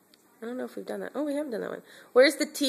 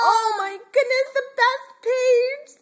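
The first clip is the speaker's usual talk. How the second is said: excited; 5 dB louder, slower than usual. Real, not acted. Mom excited at favorite page.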